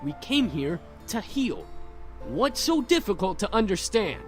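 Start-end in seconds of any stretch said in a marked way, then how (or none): 2.2-4.2 high-pitched, indignant outburst; exasperated. Acted. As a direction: demand it as if it were obvious. Argues while explaining why they came.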